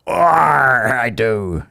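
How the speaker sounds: loud, high mock pirate "Arr"; pirate growl. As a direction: ham up a pirate for a laugh. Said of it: "So you think Americans talk like pirates?".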